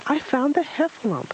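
very high excited squeal; squeaky little creature boasting; Piglet. A narrator's reading. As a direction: squeal it proudly, far up in pitch. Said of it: "Piglet squealed even louder".

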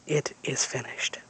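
soft final whisper. A narrator's reading. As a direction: breathe the last words out. Dream ends, final words.

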